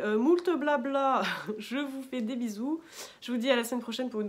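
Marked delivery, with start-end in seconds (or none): 0.0-2.8 laughing. Real, not acted. Playful sign-off.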